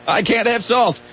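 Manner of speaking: put-on high, slow creaky old-man complaint; grumpy old man. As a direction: whine it like a cranky old man. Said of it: "what caption goes with that picture".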